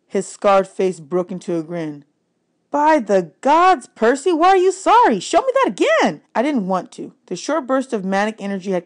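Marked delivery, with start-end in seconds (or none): 2.7-6.2 much higher, slow, astonished outburst; a swordsman stunned by a beginner's move; high, delighted disbelief; amazed Luke. Acted. Luke amazed after the disarm.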